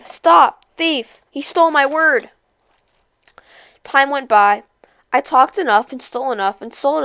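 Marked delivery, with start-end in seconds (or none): 0.0-2.3 yelling. A narrator's reading. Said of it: "chased me down a road yelling".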